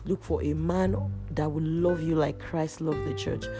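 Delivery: normal talking voice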